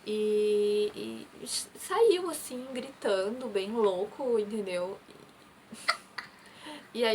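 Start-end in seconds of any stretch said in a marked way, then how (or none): none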